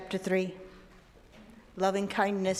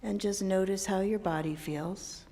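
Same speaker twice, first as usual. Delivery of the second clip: guided meditation; 7 dB quieter than usual. Acted. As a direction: guide them gently into calm. Leads a meditation.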